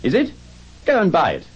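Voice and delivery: high, eager shouted question; calling down. Acted. Scrooge asking the boy about the poulterers, high eager call.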